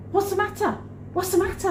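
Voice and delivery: high, urgent acted question; hammed-up confusion; bewildered reply. She acts her bewildered reply.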